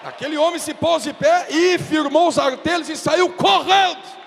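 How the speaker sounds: high, loud preaching shout; preaching at full force. Acted. Pastor preaching the story of Peter to the congregation at full force. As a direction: drive it home to the church.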